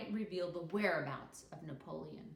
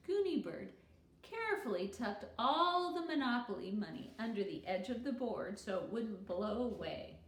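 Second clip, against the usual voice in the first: plain reading voice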